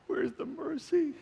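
high, slow, laughing plea; laughing complaint. Real, not acted. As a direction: laugh it out, mock outrage. Joking complaint.

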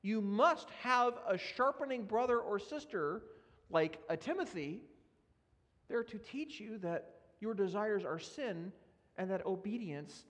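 ordinary talk

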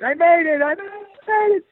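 high tearful cry of disbelief, voice breaking; crying with joy. Retold or imitated. Driving home after landing the role, crying with joy. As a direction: sob it out, overwhelmed that the dream came true. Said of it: "up over the hill crying".

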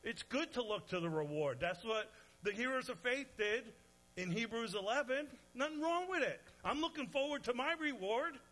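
high, loud preaching climb; impassioned preaching. Real, not acted. Preacher to congregation about heavenly reward, fiery preaching. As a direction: preach it out with fire.

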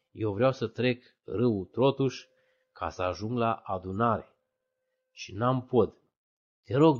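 slow, pleading prayer to Jesus; a simple believer's prayer, slow; earnest prayer. Retold or imitated. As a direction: pray it slowly, word by word. Prays on the riverbank.

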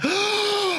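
big, high acted gasp; delighted gasp. Traveler looking down and discovering the footrest, high delighted gasp. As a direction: gasp in mock amazement. Acting out discovering the couch seat.

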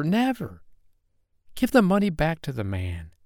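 put-on high-pitched, desperate little boy; impassioned plea, pitched well above narration; crying out, pleading. A narrator's reading. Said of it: "cried poor little Carl".

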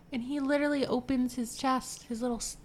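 usual speaking voice

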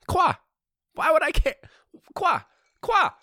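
very high, loud squawks; hammed-up outraged yelps; comic disbelief. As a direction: fire off shrieked bursts of "quoi". Comic bursts of French disbelief.

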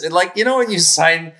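ordinary talk, nothing marked

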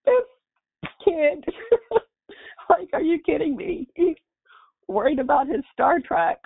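high, laughing through the words; real laughter of relief breaking through; high-pitched laughing disbelief, half crying. Real, not acted. Mother laughing at son's request.

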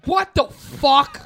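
very loud, drawn-out exclamation; hammed-up, high, booming disbelief; retold outburst. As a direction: belt it out slowly, word by word. Retold outburst walking past cameras.

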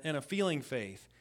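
usual speaking voice

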